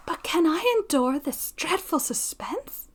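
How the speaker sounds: mild distress in a high, half-whispered voice; anguished heroine. Acted. Young woman laments the suspense to her friend, pleading.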